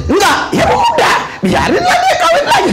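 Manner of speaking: high-pitched, laughing reply; high laughing quip. Retold or imitated. Pastor jokes to the congregation, laughing high. Pastor voices a joking answer.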